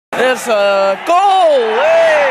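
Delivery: shouting at the pitch; yelling from the sidelines. Real, not acted. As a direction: yell it out from the stands. Fan yelling at football match.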